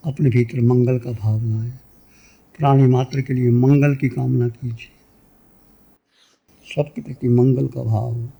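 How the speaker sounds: low, calm meditation voice with long pauses; guided meditation. Real, not acted. Teacher leading listeners into meditation, calm and low. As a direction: guide them inward in low, unhurried phrases.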